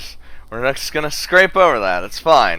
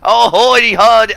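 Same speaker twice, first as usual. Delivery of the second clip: very loud, high country twang attempt; badly faked country accent, drawn out; 11 semitones higher, 12 dB louder than usual. Shown as a demonstration. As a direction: belt out a botched country accent. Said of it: "like, country accent".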